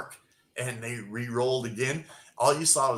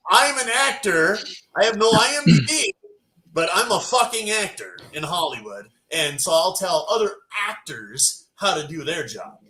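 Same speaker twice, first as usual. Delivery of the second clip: mock arrogant actor; 6 semitones higher, 6 dB louder than usual. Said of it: "mocking the background actor".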